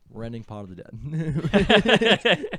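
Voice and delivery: slow, loud, laughing outro; dramatic sign-off. Acted. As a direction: drag it out like a trailer. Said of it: "run back the bit".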